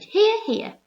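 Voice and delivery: high, loud hear hear; cheering shout. A narrator's reading. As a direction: cheer in agreement. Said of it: "they all shouted".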